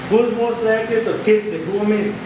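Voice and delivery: ordinary delivery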